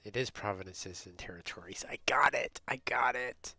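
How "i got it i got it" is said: loud, punched triumph; triumphant. Real, not acted. Finally getting provinces and territories right, triumphant. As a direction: burst out with sudden success.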